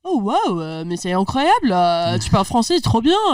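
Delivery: a lightly voiced, amazed French waiter; impressed waiter. Retelling the restaurant server's delight, lightly voiced amazement. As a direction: play a waiter charmed by simple French.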